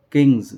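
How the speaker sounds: किंग्स ending with a buzzing z; ज़ वाली ध्वनि में किंग्स; z sound ending. Shown as a demonstration. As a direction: finish किंग्स on a voiced z, not s. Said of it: "ये है जेड sound".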